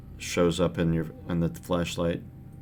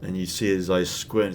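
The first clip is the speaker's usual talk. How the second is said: ordinary delivery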